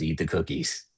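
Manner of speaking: "Eat the cookies" dropped soft and low; a hushed inner voice; brain's quiet echo. Retold or imitated. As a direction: let "Eat the cookies" slip out softly, like a thought. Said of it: "All your brain hears is".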